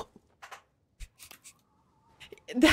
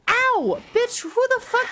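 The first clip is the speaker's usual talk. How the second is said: much higher shocked yell; startled yelp; 11 semitones higher than usual. Real, not acted. Gamer gets hit from nowhere, high startled yell. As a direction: caught off guard by a hit.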